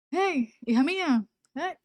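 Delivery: más agudo y lento, llamando con ternura; voz de Dios tierna. Retold or imitated. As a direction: como un padre que despierta a su hija. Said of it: "Él me habla mucho así". (Dios llama a su hija, agudo y lento)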